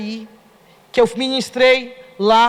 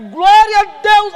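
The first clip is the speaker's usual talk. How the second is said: high, loud, slow shout of praise; shouted glória a Deus; 8 semitones higher, 7 dB louder, slower than usual. Retold or imitated. Shouting praise beside Davi at the rainbow, loud and high. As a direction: burst out praising God at the rainbow. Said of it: "Soltei aquele glória a Deus".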